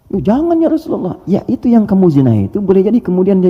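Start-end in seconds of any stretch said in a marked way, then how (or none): 0.1-1.2 high pleading voice of the man; begging the Prophet not to; pleading reply. Retold or imitated. Orang menjawab Nabi dalam kisah. (suara memohon dengan nada tinggi)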